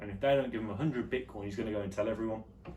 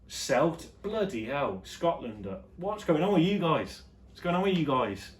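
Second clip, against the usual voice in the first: loud, high mock telling-off; hammed-up indignant shout; mock outrage; 7 semitones higher, 5 dB louder than usual. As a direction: call them out in playful exasperation. Mock telling-off, then says joking.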